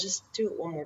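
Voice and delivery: a quiet voice in your head urging you on; hushed encouraging inner voice; little inner voice. Retold or imitated. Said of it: "that little voice that says".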